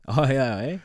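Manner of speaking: Canadian eh. Shown as a demonstration. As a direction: lift the eh like a proud Canadian. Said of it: "We say it all the time".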